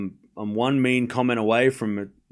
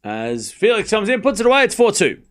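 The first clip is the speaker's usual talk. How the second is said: excited point call; 7 semitones higher than usual. Real, not acted. Calling the winning point for listeners, rising excitement. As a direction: build up as Felix puts it away.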